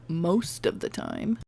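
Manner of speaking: quiet slow sardonic repeat; dry muttered aside. Real, not acted. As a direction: mutter it wryly under your breath.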